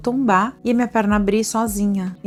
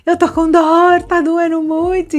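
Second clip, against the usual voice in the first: retold pained wail; 11 semitones higher, 6 dB louder, slower than usual. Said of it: "comecei a gritar em português".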